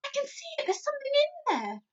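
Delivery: put-on very high child's cry; excited girl cry. A narrator's reading. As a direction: cry out amazed. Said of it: "cried out".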